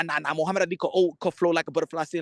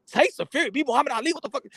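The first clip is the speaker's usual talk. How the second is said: higher, fast disbelief; can't believe what he just read; shocked; 6 semitones higher, faster than usual. Real, not acted. Reading a shocking boxing claim, disbelieving.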